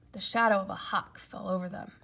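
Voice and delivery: ordinary delivery, nothing marked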